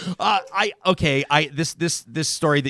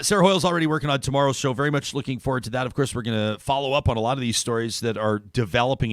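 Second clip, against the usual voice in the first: ordinary delivery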